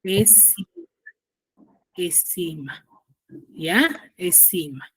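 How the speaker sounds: soft, careful "Es cima"; over enunciating. Shown as a demonstration. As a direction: say "Es cima" quietly, syllable by syllable. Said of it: "teacher models the answer".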